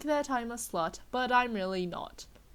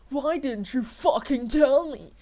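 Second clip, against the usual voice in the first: shouting; 3 semitones higher, 9 dB louder than usual. A narrator's reading. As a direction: yell it at her, betrayed. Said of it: "he shouted".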